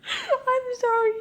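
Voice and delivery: high, laughing through the words; can't stop laughing; laughing apology. Real, not acted. Laughing fit after reading.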